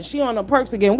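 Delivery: ordinary talk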